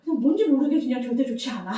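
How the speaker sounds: quick, loud line broken by laughter; laughing quote. Retold or imitated. Mother quoting her daughter's refusal, laughing. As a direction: rush it out while laughing. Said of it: "그랬더니".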